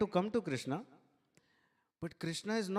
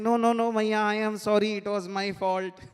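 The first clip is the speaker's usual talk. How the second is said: loud, higher hammed-up apology; emphatic acted child's protest; 5 semitones higher, 9 dB louder than usual. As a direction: insist over the other, loudly taking the blame. Child outdoing mother's apology in retelling.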